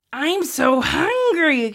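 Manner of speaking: hammed-up starving boy; dramatic hungry kid.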